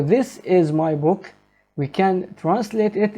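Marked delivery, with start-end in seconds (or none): 0.0-1.3 slow, word by word; slow model sentence. Shown as a demonstration. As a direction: read the model slowly for learners.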